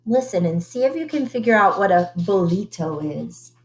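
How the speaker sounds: usual speaking voice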